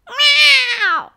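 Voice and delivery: high, loud alarmed meow; loud high meow, falling at the end; cat's cry. A narrator's reading. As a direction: yowl loudly like a panicking cat. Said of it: "cried Wilbur outside Winnie's door".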